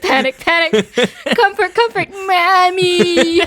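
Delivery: high, loud, panicky rising; escalating panic, laughing; frantic rising voice. Acted. Acting out panicking brain.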